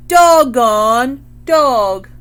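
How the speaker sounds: very loud, slow squawk; parrot squawk. A narrator's reading. Parrot mimics the mom, very loud slow squawk. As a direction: belt it out like a parrot. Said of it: "squawked the parrot".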